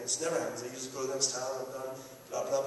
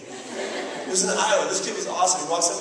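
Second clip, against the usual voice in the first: usual speaking voice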